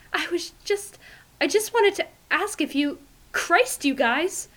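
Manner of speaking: high, shaken breathless voice; rattled and gasping; breathless fright. A narrator's reading. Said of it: "in a small, breathless voice".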